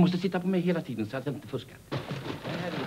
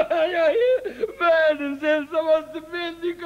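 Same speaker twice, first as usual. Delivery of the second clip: high, slow hammed-up blubbering; comically sobbing plea, much higher; mock crying; 13 semitones higher, slower than usual. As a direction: whine it like a deserted child. Begging not to be left.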